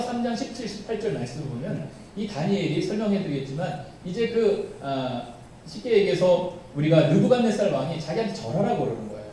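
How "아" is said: ordinary delivery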